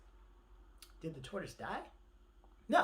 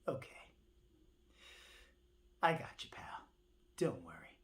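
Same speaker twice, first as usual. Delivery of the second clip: hushed low bird; 9 semitones lower, 6 dB quieter than usual. Acted. Bird's hushed reply.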